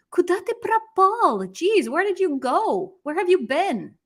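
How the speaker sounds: high-pitched, acted astonished question; exclaimed greeting. Acted greeting to somebody not seen in a long time, high-pitched exclaimed surprise. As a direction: exclaim it as if amazed they have turned up. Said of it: "see somebody and you haven't seen them".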